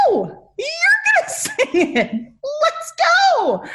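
high, laughing, slow push; laughing insistence. Retold or imitated. Friends pushing her to sing, high laughing insistence. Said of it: "they're like".

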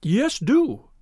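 nervous, pleading assent. A narrator's reading. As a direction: beg him eagerly. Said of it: "pleaded Thaddeus Sholto".

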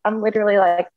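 ordinary talk

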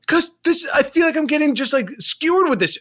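upset, high-voiced complaint; voice up, intense. Host feels blamed by his friend, voice high and intense. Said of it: "So your voice goes up. Your, your tone is intense".

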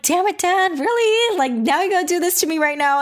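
overwhelmed, scolding dad through tears; exasperated tearful outburst. Retold or imitated. Teary retelling of dad's gesture.

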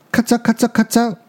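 high, quick imitated crunching; biting noise. A narrator's reading. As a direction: chomp rapidly with the voice. Sound of biting, voiced by narrator. (又高又快地模仿咔嚓咬声)

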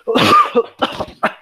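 sharp coughing fit; coughing up a swallowed bug; choking cough. Real, not acted. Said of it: "eu engoli um mosquito aqui".

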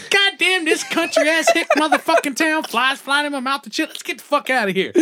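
very high-pitched mock rant; changed voice, outraged. Imitating a reporter who swallowed a fly, high outraged rant. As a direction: drop the news voice and squeal in outrage. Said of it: "changed your entire voice and attitude".

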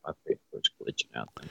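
choppy, cutting-out mic audio; radio breaking up. Real, not acted. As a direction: voice dropping out over a bad connection. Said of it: "Your, your microphone's breaking up on us also".

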